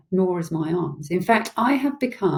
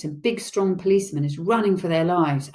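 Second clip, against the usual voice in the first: ordinary delivery, nothing marked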